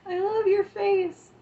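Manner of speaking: a high gushing coo at a friend; gushing squeal. Real, not acted. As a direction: squeal it with delight.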